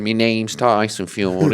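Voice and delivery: slow, low Manchester-accented introduction; prime Mancunian. Shown as a demonstration. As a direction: demonstrate a thick Mancunian accent, slowly. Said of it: "if you were going prime Mancunian".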